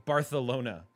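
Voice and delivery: "Barcelona" with th for the c; lisped Barcelona. Retold or imitated. Streamer mimicking people who irk them, lisped Barcelona. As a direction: say "Barcelona" as Bar-the-lona. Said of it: "with a lisp".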